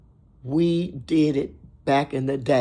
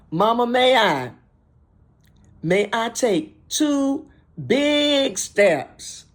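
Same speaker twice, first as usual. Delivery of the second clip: higher, drawn-out call from a player in Mama-may-I; player asking Mama; 7 semitones higher, slower than usual. Acted. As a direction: ask permission in a raised voice, stretching the big steps. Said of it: "Or you might say".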